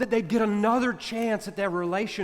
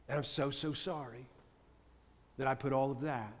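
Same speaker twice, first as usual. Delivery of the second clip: much softer and lower, a sincere hushed sorry; remorseful apology; 7 semitones lower, 11 dB quieter than usual. Retold or imitated. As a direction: apologize almost under your breath, ashamed. Friend's remorseful apology to colleagues.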